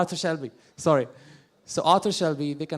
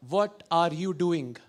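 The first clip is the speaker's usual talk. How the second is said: slow, fully pronounced Indian English; every letter sounded, Indian accent; slower than usual. Shown as a demonstration. Accent comedian demonstrating Indian English to audience, Indian accent. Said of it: "fun fact about Indian accent".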